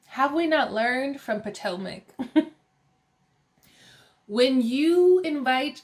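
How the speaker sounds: ordinary talk, nothing marked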